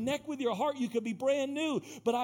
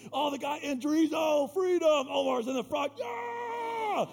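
mock cheering shout; 6 semitones higher, 3 dB louder, slower than usual. Cheering at men's ministry.

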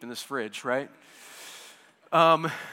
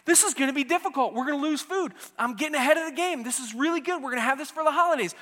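high, fast, eager pleading; excited husband making his case; pouring out logic; 9 semitones higher, 5 dB louder, faster than usual. Retold or imitated. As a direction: rush through every argument, higher. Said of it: "all of my beautiful logic".

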